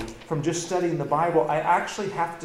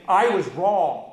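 "I was wrong" said louder and heavier than "I'm sorry"; reluctant admission; 4 semitones higher, 10 dB louder, much slower than usual. Shown as a demonstration. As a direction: weigh each word of "I was wrong". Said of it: "Get them to go say".

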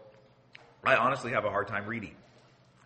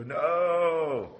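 put-on child's voice; 7 semitones higher, 4 dB louder than usual. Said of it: "And the little kids go".

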